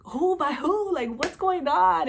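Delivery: high, imitated friend's alarm; incredulous questioning. Friend asking who jumped Laura, incredulous. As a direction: retell the astonished questions, pitched well up.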